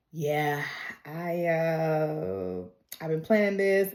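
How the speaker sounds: low, soft, hesitant confession; sheepish admission. Retold or imitated. Marty admits his plan to Courtney, low and hesitant.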